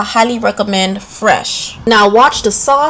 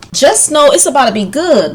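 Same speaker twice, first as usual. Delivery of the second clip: normal talking voice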